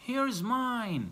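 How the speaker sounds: high excited call of Here's mine!; shouted. Acted. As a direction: call out like spotting your suitcase. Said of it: "shouted باسم". (صيحة فرحانة عالية)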